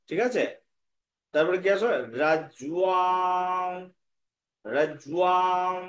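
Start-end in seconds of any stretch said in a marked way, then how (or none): 2.2-5.8 রাজ্যুয়াম with the last syllable held long; pronunciation model. Shown as a demonstration. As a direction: glide slowly into a sustained nasal "m" ending on রাজ্যুয়াম. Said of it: "উচ্চারণ হবে এখানে".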